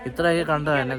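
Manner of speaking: ordinary delivery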